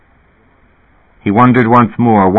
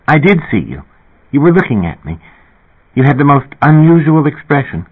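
put-on higher, eager young woman, fast; excited singer asking a favor, higher voice; Linda Fox; 6 semitones higher than usual. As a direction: rush through the request, pitched up.

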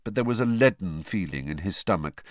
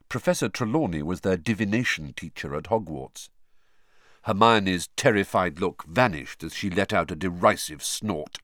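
ordinary delivery

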